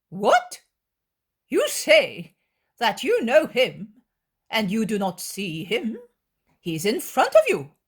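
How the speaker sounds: loud, high feigned surprise; mock disbelief. Scribe feigning surprise to trick her, loud. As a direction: act amazed to trap her.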